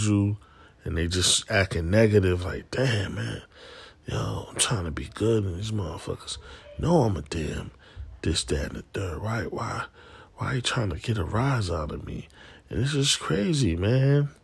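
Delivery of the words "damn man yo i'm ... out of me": frustrated mutter. Retold or imitated. Frustrated inner complaint about negative people.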